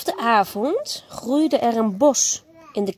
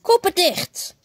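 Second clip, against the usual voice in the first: commanding shout; 6 semitones higher than usual. A narrator's reading. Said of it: "Tot Max riep".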